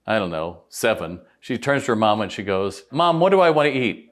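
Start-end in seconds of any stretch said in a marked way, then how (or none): 2.9-4.1 lightly voiced small child, a bit higher; a hint of a kid asking mom; little girl voice. Young girl at Starbucks asks her mom, lightly voiced child. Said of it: "this young girl who's like seven".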